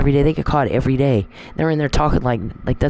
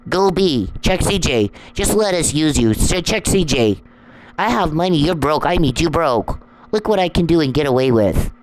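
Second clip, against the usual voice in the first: mocking the harassers; 4 semitones higher than usual.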